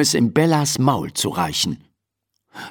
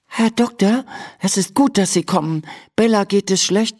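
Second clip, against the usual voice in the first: put-on higher, light girl's voice, worried; girl Emma; 9 semitones higher than usual. A narrator's reading. (besorgtes Mädchen, hohe Stimme)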